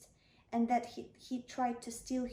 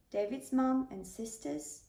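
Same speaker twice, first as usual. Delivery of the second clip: ordinary delivery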